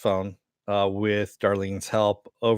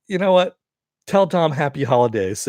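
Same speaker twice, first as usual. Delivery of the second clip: higher, louder gloating sign-off; taunting; 4 semitones higher, 5 dB louder than usual. Retold or imitated. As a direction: gloat as you hang up. McGuire taunting before hanging up.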